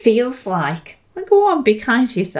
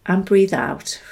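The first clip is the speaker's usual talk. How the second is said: guided meditation; 2 semitones lower, 5 dB quieter than usual. Acted. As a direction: guide the listener through each breath, gently. Leads three breaths together.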